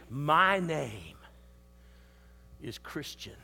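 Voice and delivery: hushed declaration of faith. Acted. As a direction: breathe the name like a secret.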